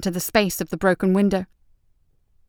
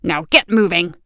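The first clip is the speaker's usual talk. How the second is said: growling; 3 semitones lower than usual. A narrator's reading. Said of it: "the sergeant growled".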